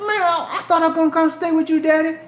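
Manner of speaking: mock high-pitched kid's protest; pleading youngster. Teen protesting to dad who leaves him, high pleading.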